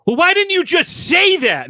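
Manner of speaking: why didn't you tell me, huffy; exasperated retort. Retold or imitated. Girlfriend learning the ring was from his mom, exasperated.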